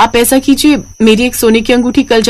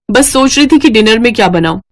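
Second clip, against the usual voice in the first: ordinary delivery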